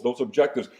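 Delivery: ordinary talk, nothing marked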